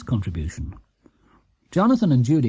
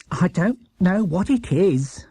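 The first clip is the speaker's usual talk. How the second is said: Mrs Bird, grudging praise; 5 semitones higher, slower than usual. Acted. Mrs Bird voiced in story.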